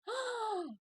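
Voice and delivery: high, imitated gasp of alarm; shocked gasp. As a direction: gasp a high "oh" in mock horror. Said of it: "everyone goes".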